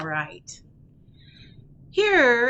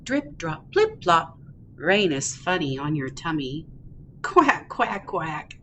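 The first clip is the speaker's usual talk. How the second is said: happy duckling, picture-book reading. Acted. Ducky off to play in the rain, happy read-aloud. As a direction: read playfully to toddlers, bouncing the quacks.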